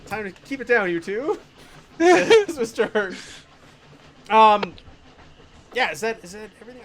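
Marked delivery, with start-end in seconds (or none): none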